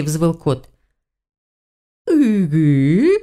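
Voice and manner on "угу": long swooping "uhu"; drawn-out mock cat howl; echoing the cat's yowl. A narrator's reading. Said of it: "в тон ему отозвалась целительница".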